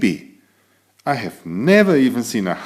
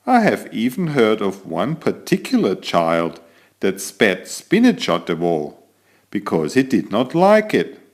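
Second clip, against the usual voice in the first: ordinary delivery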